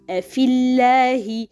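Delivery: "Afillahi" with a thin, light l and front vowel after it; light lam. Shown as a demonstration. Teacher showing tarqiq after kasra, light lam. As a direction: keep the lam of "Afillahi" light, mouth unfilled. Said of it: "read lightly with tarqiq".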